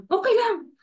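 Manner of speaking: high, warm mock mother voice; mother's voice. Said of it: "Ganun yung mother ko". (boses ng nanay, mataas at malambing)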